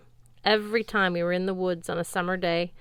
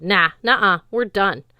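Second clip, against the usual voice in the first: huffy refusal; 9 dB louder than usual. Acted. Sword snubbing Frey.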